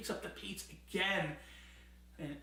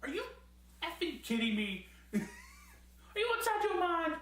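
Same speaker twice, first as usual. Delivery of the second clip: imitating a stunned wrestler, pitch jumping much higher at the end; mock Samoa Joe outrage; 12 semitones higher, faster than usual. Samoa Joe reacting to a kick, mock disbelief. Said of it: "He's like".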